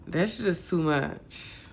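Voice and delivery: normal talking voice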